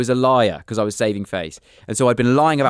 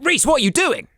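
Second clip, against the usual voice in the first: teacher's shout. Retold or imitated. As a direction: call him out like a startled teacher. Teacher catches him mid-trousers.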